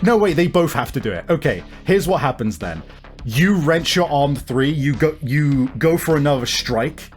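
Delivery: normal talking voice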